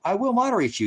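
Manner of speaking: high, loud angry threat; yelled threat. Real, not acted. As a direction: yell the warning at the troll. Threat repeated, then yelled.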